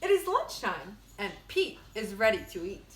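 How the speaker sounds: lively storybook read-aloud; bright picture-book narration; reading to children. Acted. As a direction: read it like story time for kids. Reads picture book to class.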